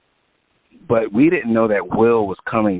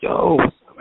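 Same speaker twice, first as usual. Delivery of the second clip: excited greeting; 11 semitones higher, 4 dB louder than usual. Retold or imitated. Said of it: "I was like".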